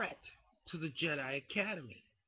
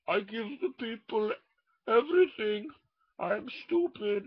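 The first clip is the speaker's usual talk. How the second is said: Bane voice; 5 semitones higher, 5 dB louder than usual. Retold or imitated. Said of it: "I am Bane".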